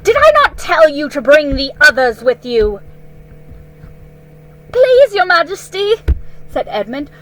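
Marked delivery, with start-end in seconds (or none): 0.0-2.8 loud, higher angry demand; furious queen. Acted. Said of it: "in a terrible voice". 4.7-5.9 high, loud desperate plea; pleading boy. Acted. Edmund pleads with the queen.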